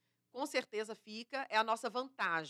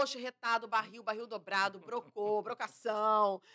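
louder, playful Bahian slang list; light Bahian accent, louder with a laugh; Bahian slang demo; 6 dB louder, slower than usual. Shown as a demonstration. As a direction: rattle off Bahian slang proudly. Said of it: "Eu falo tudo, ó".

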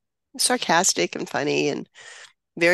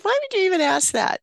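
pitched well up, incredulous; a mock-offended why ask that; taken-aback question; 12 semitones higher, 3 dB louder, faster than usual. Mom to son on the phone, high incredulous question. Son asks her to stay close.

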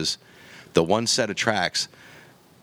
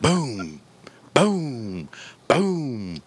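a run of imitated shots rising higher; gunshot booms; 4 semitones higher, much slower than usual. Hunter retelling emptying his gun into the deer, mock booms. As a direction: fire off each boom like a rifle shot.

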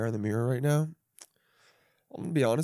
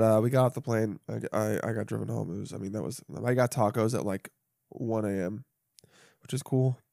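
ordinary talk, nothing marked

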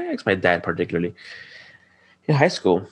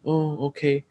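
meek compliance; 8 semitones higher, slower than usual. Retold or imitated. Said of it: "just, like, flat out".

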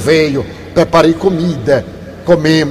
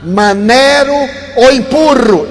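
loud call from down the line, higher; 6 semitones higher, slower than usual. Retold or imitated. Bodyguard shouting from the line to the speaker, loud. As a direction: shout it across the queue. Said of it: "ele grita de lá".

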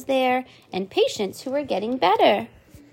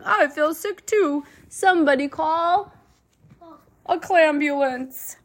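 slow, higher laughing sick voice; 6 semitones higher than usual. Acted. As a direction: chuckle through pretending to be sick. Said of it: "Mister Grouper said, laughing".

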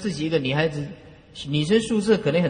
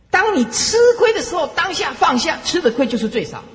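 much higher and louder, urging the crowd; soaring earnest preaching cry; emphatic exhortation; 11 semitones higher, 4 dB louder than usual. Real, not acted. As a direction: drive the lesson home from the pulpit. Preacher's emphatic teaching point.

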